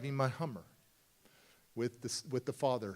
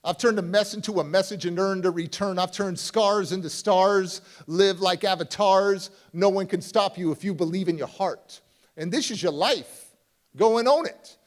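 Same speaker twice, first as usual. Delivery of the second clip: louder, rhythmic spoken-word delivery, rising to punches; poem recitation; 4 semitones higher, 8 dB louder than usual. Acted. Closing his talk to the audience, rousing poem recital.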